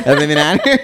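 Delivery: laughing while talking. Real, not acted. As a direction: blurt it through laughter. Laughing outburst.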